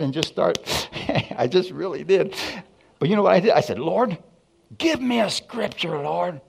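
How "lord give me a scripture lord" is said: high, urgent retold plea; urgent mock prayer. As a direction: act out begging God in a raised voice. Angry plea to God retold.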